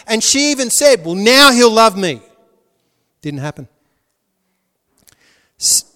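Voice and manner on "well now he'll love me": louder, eager hope that he'll love her; a wife hoping her husband finally loves her, louder; hopeful Leah. Retold or imitated. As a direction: voice Leah pleading to be loved, louder. Voicing Leah's hopeful words.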